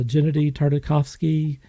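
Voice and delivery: ordinary talk, nothing marked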